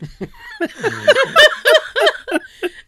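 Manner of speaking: long high laugh; loud laugh. Real, not acted. Friend laughing about the photo, loud high laugh.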